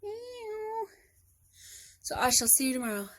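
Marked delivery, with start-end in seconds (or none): 0.0-0.8 high, soft imitated meow; playful high mew; cat meow. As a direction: meow back at the kitty. Meowing back at the cat.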